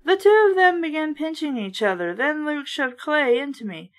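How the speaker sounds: plain reading voice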